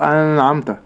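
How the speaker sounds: أنعمت with the n held and hummed; wrong nasal hold. Shown as a demonstration. Sheikh showing what not to say, a lengthened nun in أنعمت. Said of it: "فتظهر النون إظهارا".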